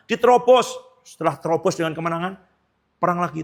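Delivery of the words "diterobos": sharp, loud and high one-word command; commanding God. Acted. Preacher voicing God ordering David to charge, loud command. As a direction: bark the order like God in battle.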